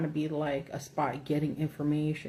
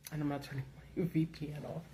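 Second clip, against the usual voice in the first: whispered with a little laugh; hushed giggly aside; 6 dB quieter, faster than usual. Real, not acted. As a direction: whisper it to yourself.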